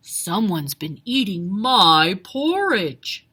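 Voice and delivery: low, loud, slow bear roar; deep booming father bear; Papa Bear roaring. A narrator's reading. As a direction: roar it like a big angry bear. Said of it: "roared Papa Bear".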